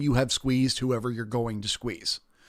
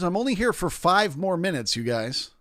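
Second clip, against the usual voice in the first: normal talking voice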